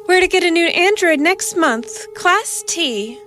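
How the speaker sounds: high, airy society-lady voice; elegant casual society lady. A narrator's reading. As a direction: toss it off with elegant ease. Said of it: "said with an elegant casualness".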